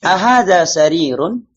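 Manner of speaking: Ahadha sarirun slow and loud, asked; "Ahadha sarirun" drawn out for the class; question intonation. Shown as a demonstration. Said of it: "Intonasi bertanya".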